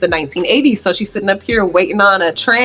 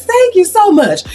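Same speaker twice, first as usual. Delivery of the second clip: higher, sweet thank you; gushing thanks; 11 semitones higher, 4 dB louder than usual. Acted. Jane thanking Jean for the praise, high and sweet. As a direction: gush it up high. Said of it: "Jane says".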